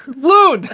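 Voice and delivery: high, loud wailing bird call; loon call. Pretend. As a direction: imitate a loon's cry. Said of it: "How's my loon call?".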